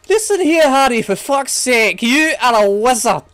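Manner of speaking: high, loud, slow shout as Hagrid; exasperated Hagrid shout. Retold or imitated. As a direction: lose patience and yell at Harry. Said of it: "freaking Hagrid".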